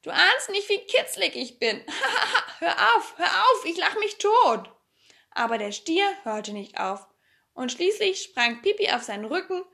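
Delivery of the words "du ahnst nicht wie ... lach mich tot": high-pitched, giggly protest; helpless with acted ticklish laughter; laughing shriek. A narrator's reading. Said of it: "schrie Pipi".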